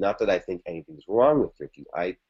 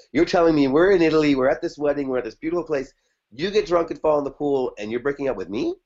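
higher and louder, baffled retelling; incredulous disbelief; 6 semitones higher, 3 dB louder than usual. Retold or imitated. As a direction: replay your own stunned protest. Incredulous retold reply to girlfriend.

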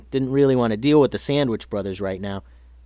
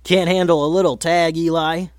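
mock taunt, pitched up and louder; teasing; 5 semitones higher, 4 dB louder than usual. A narrator's reading. Bully teases the tired boy, mocking. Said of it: "teased Marty".

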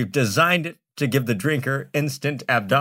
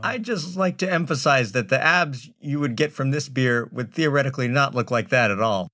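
ordinary talk, nothing marked

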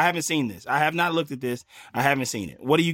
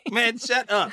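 laughing mock protest; 8 semitones higher, slower than usual. Mock overwhelmed by cute video.

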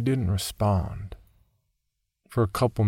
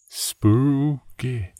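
mock spooky; 5 semitones higher than usual. A narrator's reading. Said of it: "I cried, chuckling".

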